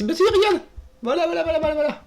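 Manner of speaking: high-pitched triumphant voilà run; excited squeal. Real, not acted. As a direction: squeak with delight at the find. Sudden find while solving puzzle.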